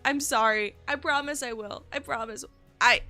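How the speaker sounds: normal talking voice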